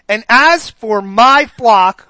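slow, solemn Bible reading; scripture reading. Acted. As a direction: draw out the verse deliberately. Reading Ezekiel to congregation.

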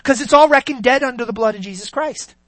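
loud, driving sermon delivery; fiery preaching. Acted. Preacher thundering at the congregation, loud and driving. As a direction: preach it hard to the church.

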